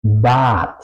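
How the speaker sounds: Bad with a long clear A; German word Bad. Shown as a demonstration. Teacher modelling Bad for Spanish learners, long A. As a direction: stretch the A in Bad, German style. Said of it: "la palabra".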